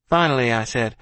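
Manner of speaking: plain reading voice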